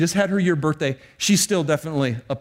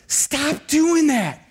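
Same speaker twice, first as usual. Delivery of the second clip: high, drawn-out scolding order; slow, emphatic mock reprimand; stern command; 9 semitones higher, much slower than usual. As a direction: order it stopped, stretching each word. Acts God giving a command.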